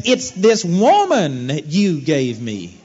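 slow, drawn-out, sulky blame; slow, drawn-out whine passing the buck; whiny Adam excuse. Retold or imitated. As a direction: drag it out like a sulking man making excuses. Adam blaming God's gift of Eve.

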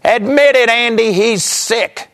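high, loud, mocking jab, the last words dragged slowly; imitating his mother's sneering taunt; sarcastic mother. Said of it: "real sarcastically and said".

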